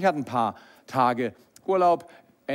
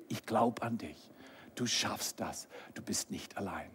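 softly voiced, low encouragement; tender reassurance; 5 semitones lower, 11 dB quieter than usual. God reassuring you and me, tender and hushed. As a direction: let it sink to a near whisper.

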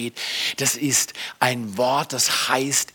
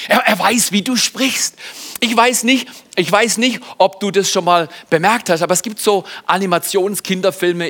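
excited; 6 semitones higher, 5 dB louder than usual. Real, not acted. Preacher bursts into excited aside.

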